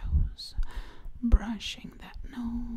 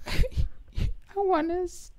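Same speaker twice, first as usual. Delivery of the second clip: laughing; 10 semitones higher, 4 dB louder, slower than usual. Real, not acted. Laughs at video's end.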